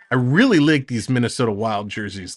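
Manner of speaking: ordinary talk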